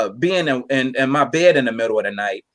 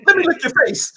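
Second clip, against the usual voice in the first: excited dog voice; 12 semitones higher than usual. Pretend. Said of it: "The dog is like".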